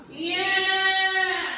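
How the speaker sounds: put-on sudden high bright yell like a baby; loud twangy yell. Shown as a demonstration. Voice coach demoing a baby's loud twang. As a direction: shout it out with full twang.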